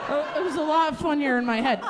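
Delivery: ordinary delivery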